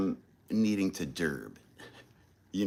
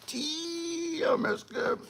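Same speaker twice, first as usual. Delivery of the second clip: squeaky delight; 9 dB quieter, much slower than usual. Real, not acted. Savoring the patty melt.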